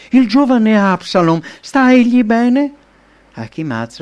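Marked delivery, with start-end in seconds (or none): 0.1-2.7 high, loud urgent question, slowing on the last words; a father pressing for news, voice raised and rising at the end; anxious question. A narrator's reading. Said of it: "carica di ansia". (domanda ansiosa, voce alta e tesa)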